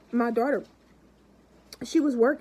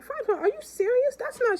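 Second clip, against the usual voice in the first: much higher shocked disbelief; astonished; 12 semitones higher than usual. Retold or imitated. Old lady stunned by the cash gift, much higher. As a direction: squeal in disbelief. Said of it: "she's like".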